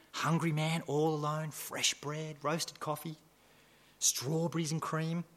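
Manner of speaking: dropping to a near-hush to list the tempting foods. Acted. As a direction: savour each temptation softly under the breath. Recalling the hungry man's temptation.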